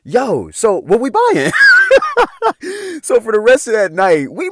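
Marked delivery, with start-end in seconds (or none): 1.6-3.0 big high laugh, louder. Real, not acted. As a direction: crack up at the punchline. Laughing about free drinks.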